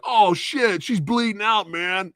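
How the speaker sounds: high, rough-edged mock cabbie panicking; taxi driver voice. Narrator voicing the taxi driver refusing the bleeding wife, high panicky cabbie voice. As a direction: play the cabbie freaking out, way up high. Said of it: "That's the voice I'm giving him".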